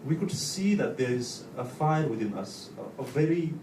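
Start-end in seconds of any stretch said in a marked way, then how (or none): none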